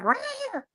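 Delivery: high-pitched mock snarl; imitated cat snarl. Imitating the cat's reply to being petted, high snarl. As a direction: squeal out feisty little "rah" noises. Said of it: "And she's like".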